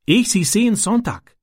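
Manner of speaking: put-on high-pitched, halting boy in broken German; Ram, a foreign boy. A narrator's reading. Foreign boy telling his friend about the gang, high and halting.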